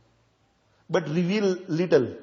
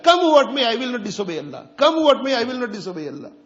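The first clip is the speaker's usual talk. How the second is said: "Come what may" much louder and higher, then the vow quicker; resolute preaching; 6 semitones higher, 6 dB louder than usual. Acted. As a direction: declare it like an unbreakable oath.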